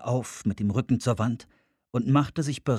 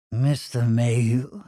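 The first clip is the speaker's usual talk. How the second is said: langsam gedehnter, öliger Anrufer; ölig, fies; 3 dB louder, slower than usual. Acted. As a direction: dehn den Namen ölig und fuchshaft. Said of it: "Der Tonfall war ölig, fies, fuchshaft". (öliger, fieser Tonfall)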